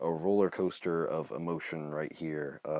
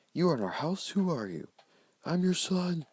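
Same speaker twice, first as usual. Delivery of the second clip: lightly acted phone argument; 12 semitones higher, 5 dB louder than usual. Voicing parent and Jimmy.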